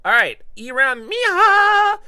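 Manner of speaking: stretched high shout; drawn-out call-out. Acted. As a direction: announce the viewer like a ring announcer. Loud call-out of name.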